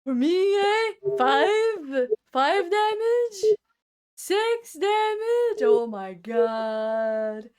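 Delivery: rising, disbelieving cries over the damage; mock outrage. Real, not acted. Indignant player protesting the damage he took, in mock outrage. Aghast at taking six damage.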